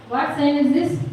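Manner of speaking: ordinary delivery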